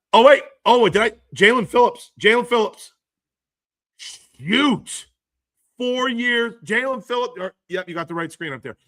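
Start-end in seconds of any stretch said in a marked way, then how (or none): 0.1-7.3 high, loud burst of surprise; surprised. Real, not acted. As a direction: caught off guard by breaking news. Sudden trade news on screen.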